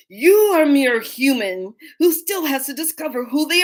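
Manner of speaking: hammed-up dragon pride, laughing; haughty dragon. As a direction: proclaim it grandly like an offended dragon. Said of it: "she says".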